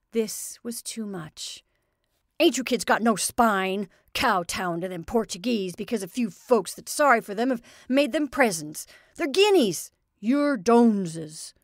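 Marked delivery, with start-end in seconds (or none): 2.4-11.5 angry scolding. Acted. Father angrily scolding kids.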